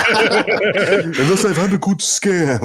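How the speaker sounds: slow, high, laughing echo. Retold or imitated. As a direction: laugh through the words. Echoing friend, laughing.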